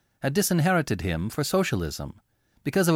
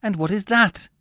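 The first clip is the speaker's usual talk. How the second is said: much higher, louder, impatient question; 10 semitones higher, 5 dB louder than usual. Acted. Laura points at the sugar cake, impatient. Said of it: "she asked impatiently".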